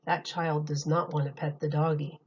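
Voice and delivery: ordinary delivery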